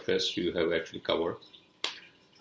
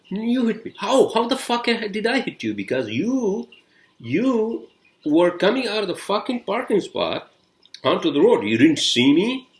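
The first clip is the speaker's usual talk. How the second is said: high, loud retold argument; indignant; 10 semitones higher, 6 dB louder than usual. Driver arguing with the man pulling out of parking, indignant. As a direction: throw the blame back, pitched up and emphatic. Retold quarrel over who caused crash.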